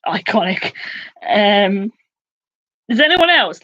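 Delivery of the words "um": ordinary talk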